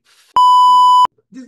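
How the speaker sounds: sudden loud high yelp cut short; cut-off swear. Real, not acted. Guest catching himself mid-swear on a podcast, loud cut-off yelp. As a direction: blurt the start of a curse and stop.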